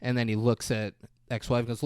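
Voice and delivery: ordinary talk, nothing marked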